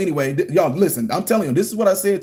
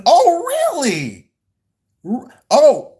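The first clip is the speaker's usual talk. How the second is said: very high, drawn-out mock astonishment; scandalized, hammed-up surprise; affronted disbelief; 10 semitones higher, 5 dB louder, slower than usual. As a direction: stretch it out high and incredulous. Acting affronted at being accused.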